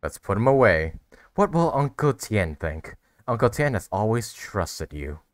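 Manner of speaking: normal talking voice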